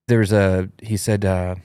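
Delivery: ordinary delivery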